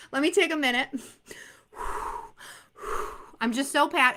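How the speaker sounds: ordinary delivery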